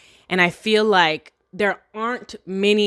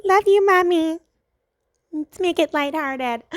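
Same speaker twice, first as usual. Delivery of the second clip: put-on high childlike voice, much higher; cutesy baby voice; 10 semitones higher than usual. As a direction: coo it like a little kid. Playful cutesy voice aside.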